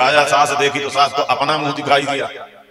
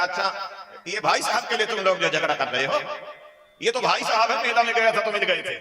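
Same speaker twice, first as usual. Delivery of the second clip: higher, laughing through the words; amused son; 6 semitones higher than usual. Acted. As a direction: chuckle as you clear up the mix-up. Son explains the mirror, amused.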